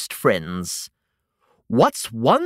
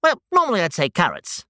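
plain reading voice

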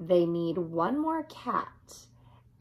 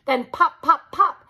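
higher and louder with each pop, laughing; popping; 7 semitones higher, 7 dB louder than usual. A narrator's reading. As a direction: bounce each pop up, delighted. Cats popping out.

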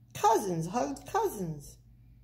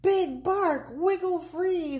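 high, urgent storybook shout; 10 semitones higher, 4 dB louder than usual. A narrator's reading. Prairie dogs urge dangling Big Bark, shouting. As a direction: call up to someone far overhead. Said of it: "the prairie dog shouted".

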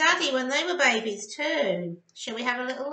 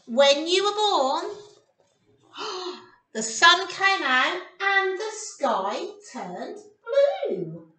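storybook reading; 2 semitones higher, 5 dB louder, slower than usual. Acted. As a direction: build wonder then settle.

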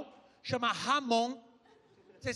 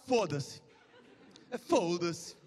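put-on Portuguese accent; 8 semitones lower, much slower than usual. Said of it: "A gente fala".

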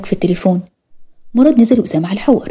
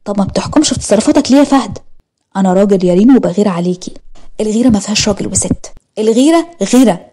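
ordinary delivery

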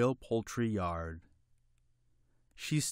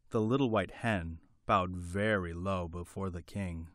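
plain reading voice

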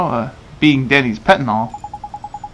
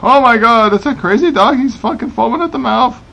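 higher, louder excited alarm; 8 semitones higher, 3 dB louder than usual. Real, not acted. Player reacts to a foaming dog, excited and higher. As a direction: blurt it out, half laughing, half shocked. Mad dog appears on screen.

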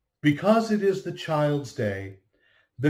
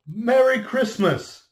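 high, loud, slow merry greeting; joyful greeting; 11 semitones higher, 5 dB louder than usual. Acted. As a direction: call it out with open joy. Greeting shouted like a child.

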